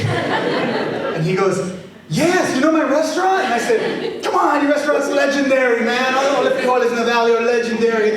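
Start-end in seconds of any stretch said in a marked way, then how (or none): 2.1-3.4 surprised delight. Acted. Restaurant owner surprised and delighted. 4.2-8.1 excited gushing. Retold or imitated. Teller gushing about the restaurant.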